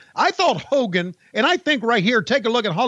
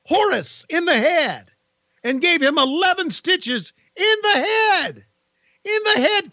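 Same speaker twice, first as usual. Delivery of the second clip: higher, excited wrestling commentary; announcer call; 9 semitones higher than usual. Acted. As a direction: call the replay with rising disbelief. Play-by-play commentary.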